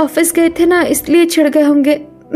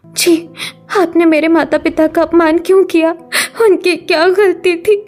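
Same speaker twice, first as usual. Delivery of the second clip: tearful protest; 13 semitones higher, 11 dB louder than usual. Acted. Wife protests tearfully, parents insulted.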